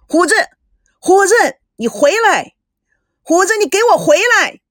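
loud, high calls after a runaway son; frantic shouting. A narrator's reading. Father chasing his runaway son, frantic shout. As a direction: yell his name as he runs off. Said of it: "狂追狂喊".

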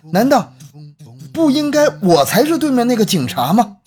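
rising, shocked question; suddenly grasp the twist; shocked realization. Acted.

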